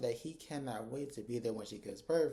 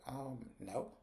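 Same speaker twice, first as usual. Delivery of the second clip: dry refusal; 9 dB quieter than usual. Retold or imitated. Fatima flatly refuses.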